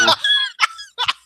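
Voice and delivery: high squealing laugh; a sudden high laugh; high laugh at the excuse; burst of laughter. Real, not acted.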